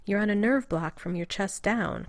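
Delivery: ordinary delivery, nothing marked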